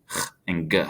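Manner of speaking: ch as a raspy throat h, then g as a hard "guh"; soft and hard G. Shown as a demonstration. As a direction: contrast breathy-throated ch with stopped g. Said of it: "a soft G and a hard G".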